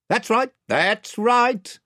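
high, drawn-out agreement; chief voice. A narrator's reading. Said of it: "said the chief voice".

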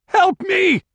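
high, loud cry for help; panicked scream. A narrator's reading. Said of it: "he screamed".

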